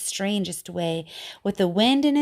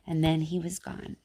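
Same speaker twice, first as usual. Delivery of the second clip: much softer, hushed close; hushed ending; 4 semitones lower, 6 dB quieter than usual. A narrator's reading. Teacher ending the sad poem, hushed. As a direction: let the story fade out.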